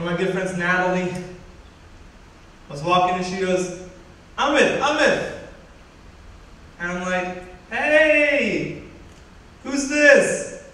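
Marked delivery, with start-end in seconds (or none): 4.4-5.3 high, loud calling-out of a name; imitated distant yell. Friend calling to him from far away, imitated yell. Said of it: "she's yelling at me from far away". 7.7-10.6 high, loud drawn-out call back; acted calling out. Student answering friend from far away, acted shout.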